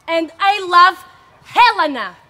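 loud, ringing declaration of love; loud cruel declaration. Acted. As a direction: throw it in her face loudly. Declaring loudly to Hermia.

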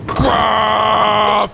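high, held "aaah" of dismay; long yell. Real, not acted. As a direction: cry out as the throw goes wrong. Yells as the throw goes wrong.

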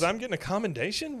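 high, thrilled question; imitating gleeful disbelief, pitch jumping up; delighted surprise. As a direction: ask it high, thrilled at the honour. Peggy thrilled by misheard word.

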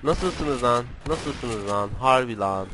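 ordinary talk, nothing marked

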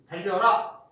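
loud, sharp order; command. Shown as a demonstration. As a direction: call out the command. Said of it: "이게 명령이야". (크게 외치는 명령)

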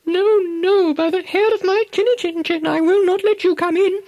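very high, quick little piglet refusing; squeaky defiant pig. Acted. Second pig refusing the wolf at the door, very high squeaky piglet voice. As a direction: stand firm in a tiny pig voice.

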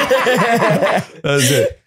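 laughing it out. Real, not acted. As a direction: laugh through the words. Laughing at credit score joke.

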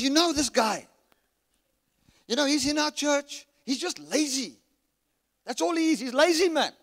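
venting loudly to his wife; exasperated rant. Retold or imitated. Complaining to his wife about the lazy church member, loud and exasperated. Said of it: "I said".

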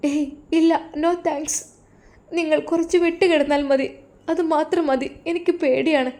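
put-on frightened young wife. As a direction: plead softly, scared of him. Wife voiced.

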